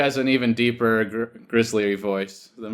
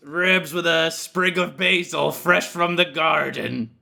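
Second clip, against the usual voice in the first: smoker's rasp; 3 semitones higher than usual. Pretend. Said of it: "Just a smoker's voice".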